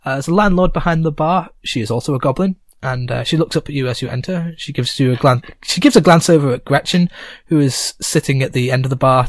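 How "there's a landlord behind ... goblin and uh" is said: ordinary talk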